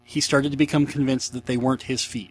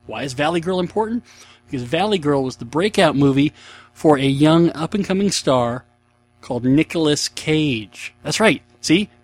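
normal talking voice

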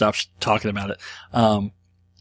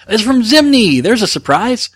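surprised reveal; 11 semitones higher, 7 dB louder than usual. Real, not acted. Dice roll reveal.

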